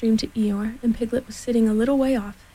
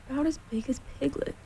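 hushed, higher, wistful murmur; sad, to himself; 4 semitones higher, 8 dB quieter, faster than usual. A narrator's reading. Eeyore taking in the balloon's size, sadly murmuring to himself. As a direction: repeat it softly and quickly to yourself. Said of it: "he said to himself sadly".

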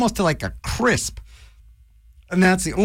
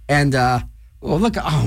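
ordinary talk, nothing marked

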